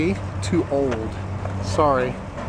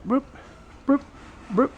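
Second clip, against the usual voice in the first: paintbrush whoop; 11 semitones higher, 4 dB quieter than usual. Shown as a demonstration. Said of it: "a paintbrush and going whoop".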